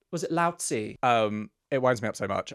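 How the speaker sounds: normal talking voice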